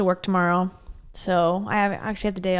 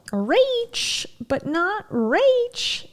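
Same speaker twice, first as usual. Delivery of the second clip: slow, high, stretched name; drawn-out celebration; 11 semitones higher, much slower than usual. Real, not acted. Congratulating a viewer.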